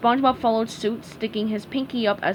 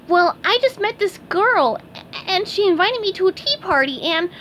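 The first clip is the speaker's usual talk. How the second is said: high, loud, eager sponge voice; bursting with excited news; high cartoon sponge voice; 9 semitones higher, 4 dB louder than usual.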